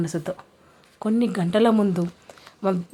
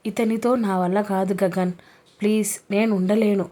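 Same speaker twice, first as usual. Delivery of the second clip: ordinary delivery, nothing marked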